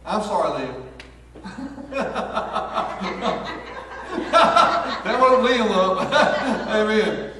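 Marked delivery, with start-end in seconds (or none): 0.8-5.0 real high burst of laughter; breathless high laugh. Real, not acted. Laughs at own slip. 5.0-7.3 words broken by loud laughter; laughing apology. Real, not acted. Laughing through correction.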